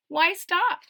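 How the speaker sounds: usual speaking voice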